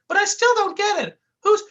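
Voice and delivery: very high, bumbling goofy voice; squeaky clumsy oaf, loud; goofy cartoon sidekick. A narrator's reading. Dummerton's voice.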